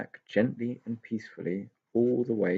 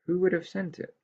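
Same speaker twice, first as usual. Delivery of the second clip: higher pixie question; 10 semitones higher than usual. Acted. Tigger sceptical.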